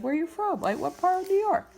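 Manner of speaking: high, loud eager question; excited. Retold or imitated. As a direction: ask with excitement. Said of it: "He's like".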